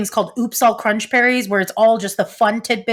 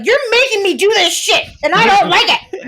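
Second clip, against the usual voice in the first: put-on high, whiny toddler voice; 11 semitones higher than usual. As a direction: sulk like a toddler. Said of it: "I was like the toddler who's like".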